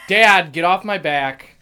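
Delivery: put-on loud, slow teenage whine at his dad; playing Russ snapping at his dad, loud and slow; whiny teen Russ. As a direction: yell it like a sulky kid at his father. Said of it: "I feel so much like Russ DeBusk right now".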